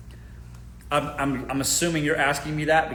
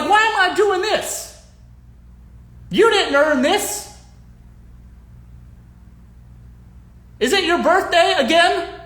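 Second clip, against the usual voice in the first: incredulous; 10 semitones higher, 5 dB louder than usual. Real, not acted. Mock disgust, laughing.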